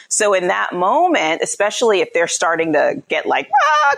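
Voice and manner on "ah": high, crazed "ah"; mock frantic cry. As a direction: imitate a child losing it. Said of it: "crazy and their frontal lobes are gone".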